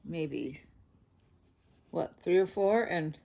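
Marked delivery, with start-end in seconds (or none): none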